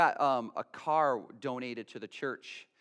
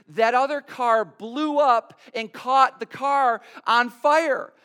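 imitating tearful girl; 7 semitones higher, 6 dB louder than usual. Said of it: "and she's crying".

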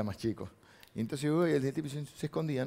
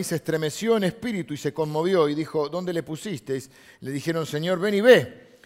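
loud, raised Bible reading; emphatic scripture reading; 3 semitones higher, 5 dB louder than usual. Acted. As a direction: proclaim the verse to the congregation.

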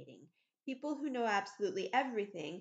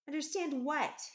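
higher, sharper retort; angry question; 7 semitones higher, 4 dB louder than usual. A narrator's reading. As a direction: demand it with irritation. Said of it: "he asked angrily".

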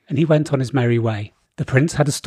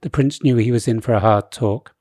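usual speaking voice

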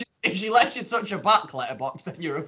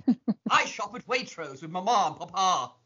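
out-posh your friend with a hammed-up posh accent; mock posh rich-boy introduction; exaggerated posh accent; 6 dB louder than usual. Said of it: "that's not posh enough".

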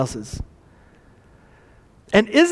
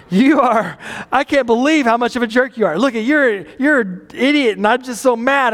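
mock rant, pitched up; angry, offended; 5 semitones higher than usual. Driver fuming at the car that cut in, angry and offended. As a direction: act out a mildly exaggerated road-rage tirade. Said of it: "angry, right? F-, super offended about it".

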